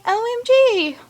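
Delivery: very high mock gush; gushing reaction. Fan imitating Jinja's amazement, excited.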